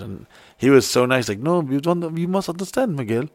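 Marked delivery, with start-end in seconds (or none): 1.4-3.2 put-on soft, higher-pitched French accent; mock French accent. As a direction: gently imitate a French man explaining kindly. Retelling a French movie star's words.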